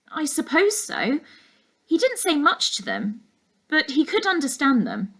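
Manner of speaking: put-on a girl unsure, thinking aloud; young girl.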